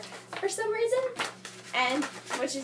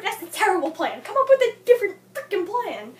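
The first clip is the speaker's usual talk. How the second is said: annoyed rant; 5 semitones higher, 5 dB louder than usual. Retold or imitated. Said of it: "I was so angry at her for that".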